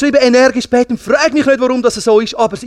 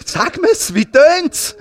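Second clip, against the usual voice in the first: high-pitched, excited plea to hear more; eager questioning; 9 semitones higher, much slower than usual. Retold or imitated. Preacher acts out asking the woman about angels singing, eager and high. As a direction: beg eagerly to be told.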